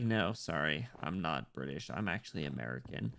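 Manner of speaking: deep, apologetic mock reply; low-voiced confession. Streamer admitting he's American, in a low mock-modest voice. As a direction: drop low to set them straight. Acts own reply to listener.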